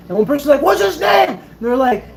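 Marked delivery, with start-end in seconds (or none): 0.6-1.3 high, excited yelled question; imitated crowd shout. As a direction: call it out over a chanting crowd. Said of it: "They started yelling".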